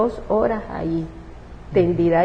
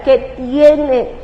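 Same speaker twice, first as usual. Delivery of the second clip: higher, tearful yell upward; crying shout at God; 4 semitones higher, 4 dB louder than usual. Retold or imitated. Mother shouting at God through tears, anguished. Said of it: "gritaba a Dios y a todo esto yo lloraba".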